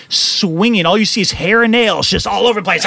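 normal talking voice

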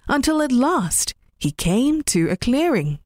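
ordinary delivery, nothing marked

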